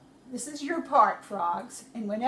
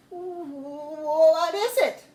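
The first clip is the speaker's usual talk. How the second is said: higher, slow question; slow high Moses; 5 semitones higher, 6 dB louder, much slower than usual. Acted. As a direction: draw out a nervous question. Moses asks God.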